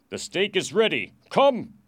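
loud, high soldier's order; soldier's command. Acted. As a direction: call the prisoner out forcefully. Soldier summons prisoner to execution.

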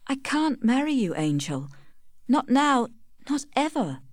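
high-pitched, pleading refusal; distressed refusal. A narrator's reading.

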